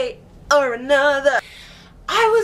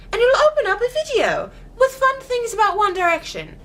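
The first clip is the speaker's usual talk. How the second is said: higher, bright customer-service voice; chirpy company reply; 6 semitones higher than usual. Retold or imitated. Zappar account answering her on Twitter, chirpy and higher. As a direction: play the overly cheerful brand account. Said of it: "And they wrote back".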